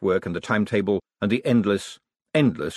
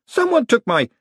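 startled; 14 semitones higher, 6 dB louder than usual. Acted. Adora startled.

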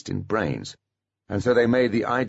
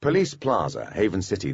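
usual speaking voice

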